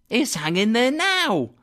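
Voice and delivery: put-on high boy calling up from the street; boy's voice. A narrator's reading. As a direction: answer eagerly as a young lad, pitched high. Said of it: "replied the boy".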